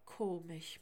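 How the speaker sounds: usual speaking voice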